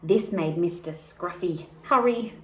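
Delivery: usual speaking voice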